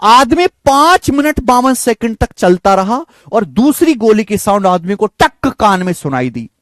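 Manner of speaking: ordinary talk, nothing marked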